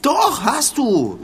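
high, slow, drawn-out protest; slow, high, whiny retort; indignant. Acted. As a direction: insist like a hurt child. Arguing who is to blame.

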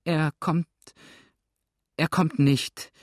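stammering, not yet composed; breathless stammer. A narrator's reading. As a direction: break it up as if short of breath. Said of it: "stammelte ... noch immer weder zu Atem".

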